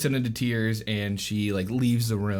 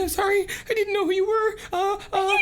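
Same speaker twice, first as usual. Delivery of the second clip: high, hurried falsetto apology; acted flustered, shy high voice; freaking out; faster than usual. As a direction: squeak out a panicked apology. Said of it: "like, freaking out".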